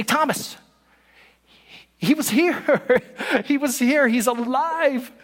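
bursting to tell Thomas he was here, drawn out; excited news. Retold or imitated. Disciples telling Thomas excitedly.

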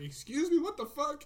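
high-pitched, offended disbelief; incredulous. Retold or imitated. Retelling shock at ticket price.

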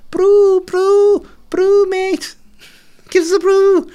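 put-on very high, loud cooing old Birmingham lady; a pigeon begging like a Brummie gran for tea; pigeon as old Birmingham woman. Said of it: "like an old Birmingham woman looking for a cup of tea".

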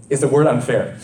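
normal talking voice

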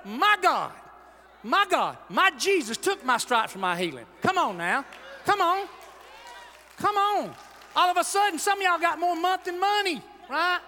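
loud, high-pitched shouting preacher; rising into a full shout; preaching at full force. Acted. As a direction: lift the crowd, preaching hard.

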